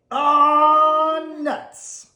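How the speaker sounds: high squeaky lament; squirrel groan. Acted. Squirrel groaning after landing in Tennessee, high. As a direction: wail it high like a tiny squirrel.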